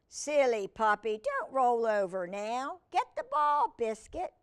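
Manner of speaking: loud, playful command to a puppy; calling the puppy. Acted. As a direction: call out brightly to a wayward pup. Girl calls to puppy in story.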